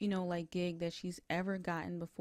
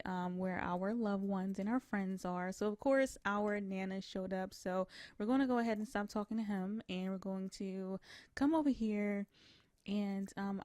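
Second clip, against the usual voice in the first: ordinary talk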